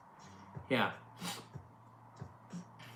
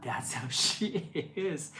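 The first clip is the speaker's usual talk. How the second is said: laughing; 3 semitones higher, 3 dB quieter, slower than usual. Real, not acted. Defending her friend, laughing.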